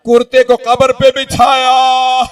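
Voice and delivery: high, swelling majlis delivery; impassioned lament preaching. A narrator's reading. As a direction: build the tragedy toward a loud wail. Lamenting narration at rising force.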